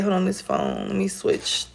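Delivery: normal talking voice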